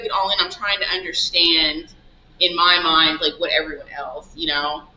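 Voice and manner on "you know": ordinary talk, nothing marked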